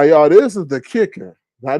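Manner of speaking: ordinary delivery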